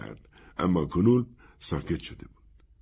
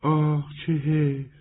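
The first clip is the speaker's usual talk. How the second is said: drawn-out, higher sigh of regret; a little saddened; 9 semitones higher, slower than usual. A narrator's reading. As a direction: let mild disappointment stretch the first words, then offer hopefully. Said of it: "کمی غمگین شد و آهسته گفت".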